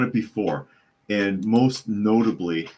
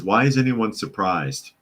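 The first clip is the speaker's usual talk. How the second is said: usual speaking voice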